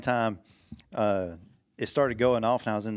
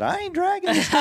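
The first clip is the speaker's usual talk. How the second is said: laughing mock quote; 5 dB louder than usual. Retelling friend's refusal, voiced.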